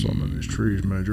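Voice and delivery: deep quiet growl; low gruff movie voice. Retold or imitated. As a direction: rumble it low and soft. Echoes quote low.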